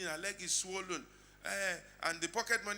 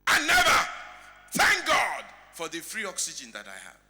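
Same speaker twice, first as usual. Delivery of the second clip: impassioned outcry; 2 semitones higher, 15 dB louder than usual. Acted. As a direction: cry out the regret at full force. Tearful rich man's realisation, acted.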